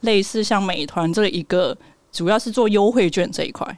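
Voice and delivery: usual speaking voice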